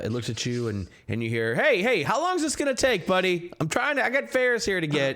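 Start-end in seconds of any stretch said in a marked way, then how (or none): 1.6-5.2 much higher, louder, pushy coachman voice; a cabbie hurrying his passengers along; impatient cab driver. Acted. Cab driver nags the party to hurry, high and loud.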